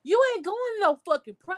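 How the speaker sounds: high, forceful scolding; angry parent. Pretend. A mother punishing her daughter, angry. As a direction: lay down the law as a furious mom.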